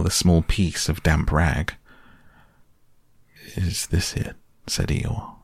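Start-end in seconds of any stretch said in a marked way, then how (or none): 3.5-4.4 a little surprised. A narrator's reading. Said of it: "a little surprised".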